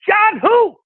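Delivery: very high, slow mock yell; dad's phone holler. Dad answers an unknown caller, high mock yell. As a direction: holler back down the line in a high voice. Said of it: "And my dad goes".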